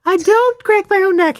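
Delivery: loud, very high mock female squeal; hammed-up indignant female client; protesting woman. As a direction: protest in a squeaky girly falsetto. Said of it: "And she'll go".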